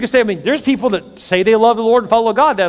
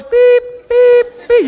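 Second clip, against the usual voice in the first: high, loud imitated backup beeper; reversing beep; 9 dB louder, much slower than usual. Imitating an excavator reversing into the yard, high beeping. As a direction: mimic a truck backing up.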